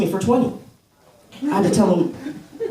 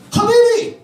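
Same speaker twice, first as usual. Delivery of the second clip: excited Habibi greeting; 6 dB louder than usual. Retold or imitated. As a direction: greet him like a long-lost brother. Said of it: "somebody coming up to me like".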